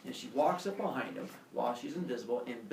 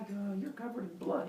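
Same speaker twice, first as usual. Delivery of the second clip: higher, softer townsperson voice; wary townsperson; 7 semitones higher, 5 dB quieter than usual. Retold or imitated. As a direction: imitate a hesitant onlooker. Townsperson taken aback by bloody stranger.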